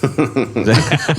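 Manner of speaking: usual speaking voice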